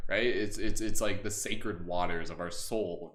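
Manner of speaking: ordinary delivery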